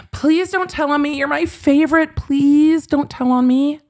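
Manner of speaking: high whiny begging, mocking Ron; whiny pleading child. Mocking Ron begging the judge not to report him, high whine. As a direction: plead like a kid caught out.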